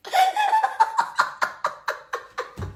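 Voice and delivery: breathy laugh bursting high; high-pitched laugh. Real, not acted. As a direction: crack up in a shrill giggle. (éclat de rire aigu et soufflé)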